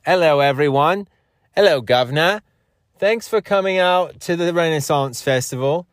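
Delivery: high, posh-toned English greeter; English accent. Pretend. Festival greeter welcoming guests in a high English accent. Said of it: "talk in an English accent".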